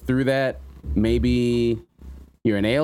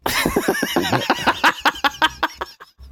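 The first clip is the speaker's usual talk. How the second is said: high shrieking laugh. Real, not acted. Host reacting to a ridiculous tweet, high shrieking laugh.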